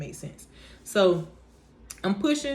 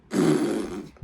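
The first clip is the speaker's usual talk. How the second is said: imitated sniff for air; 11 semitones lower than usual. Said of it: "I literally started doing that".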